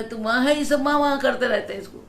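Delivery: quick, high-volume quoted outburst; retold loud exclamation. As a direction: imitate an elder calling it out. Retold baba's exclamation about name.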